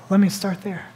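ordinary talk

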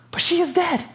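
squeaky-high acted objection; comic high-voiced contradiction; high-pitched protest. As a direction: pitch it way up in disbelief. Mock protest to Jesus.

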